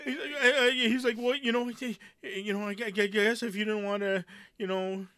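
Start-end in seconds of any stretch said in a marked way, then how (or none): 1.2-5.1 high, stammering excuse; mock sheepish stammer, pitched high; backpedaling. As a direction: imitate someone flustered and backing off. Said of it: "he started all backpedaling, all kind of freaking out".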